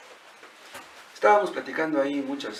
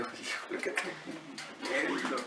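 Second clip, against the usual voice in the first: a hushed, slow sigh of dismay; awed whisper; 2 semitones lower, 12 dB quieter, much slower than usual. Retold or imitated. Preacher recalling the man's tears, whispering in dismay. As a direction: breathe it out slowly, stunned.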